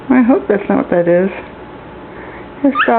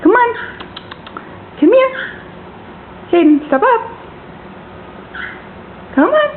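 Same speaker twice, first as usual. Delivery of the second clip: high sing-song calling to a bird; squeaky high calling to a pet bird; 10 semitones higher, 5 dB quieter than usual. Real, not acted.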